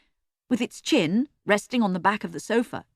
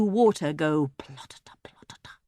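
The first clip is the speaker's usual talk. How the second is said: whispered sound effect; 3 semitones lower than usual. A narrator's reading. Erg imagining a sound.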